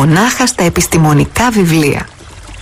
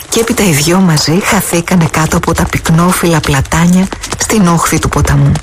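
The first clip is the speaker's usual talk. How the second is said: ordinary delivery, nothing marked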